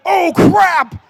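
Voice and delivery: high, loud panicked yell; a loud, high, startled cry of alarm; alarmed shout. Retold or imitated. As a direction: shout like you just saw a trunk full of guns. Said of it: "And I was like".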